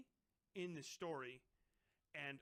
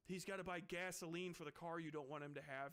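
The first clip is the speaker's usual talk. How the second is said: normal talking voice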